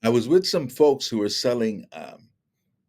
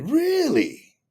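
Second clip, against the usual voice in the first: mock surprise. [name] surprised, eager.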